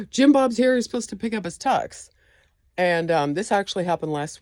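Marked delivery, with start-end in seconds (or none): 0.1-2.1 high-pitched flustered customer; lightly voiced worried customer. Customer voiced in retold story.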